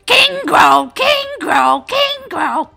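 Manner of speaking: high, very loud goblin chant; excited little goblin. Acted. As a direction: squawk it over and over like an alarm. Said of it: "the other little goblin is just running around going".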